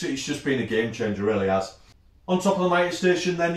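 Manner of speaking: usual speaking voice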